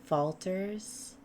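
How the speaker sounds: slow, hushed, spooky game master telling; eerie scene narration. A narrator's reading. Game master narrating the glowing door to players, hushed and slow. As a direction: draw the players in with a low hush.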